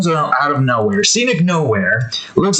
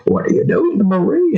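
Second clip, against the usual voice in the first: high cartoon boy voice; high-pitched mock question; Bobby; 8 semitones higher than usual. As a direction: imitate a kid questioning his dad, pitched up. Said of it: "he's like".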